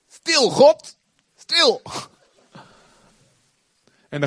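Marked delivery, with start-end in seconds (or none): 0.1-1.0 high, loud yell of stil God; shouting stil God. Retold or imitated. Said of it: "Ik weet nog precies waar ik fietste". 1.4-1.8 shouted high and loud, stil God; shouting at God. Retold or imitated. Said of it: "Ik weet nog precies waar ik fietste".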